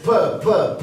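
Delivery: "pe" popped loud and high, each a separate burst; explosive P. Shown as a demonstration. Speaker showing plosives to listeners, explosive "pe". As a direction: fire off each "pe" as a small explosion of air. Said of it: "une explosion vraiment de son".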